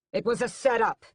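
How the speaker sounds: high, loud angry accusation; yelled. A narrator's reading. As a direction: yell it at his mother. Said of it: "Raoul yells".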